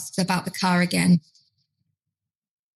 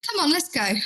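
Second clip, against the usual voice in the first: higher, gentle coaxing; meeting him gently; 8 semitones higher than usual. Retold or imitated. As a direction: coax him kindly toward the door. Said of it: "try and meet him where he's at".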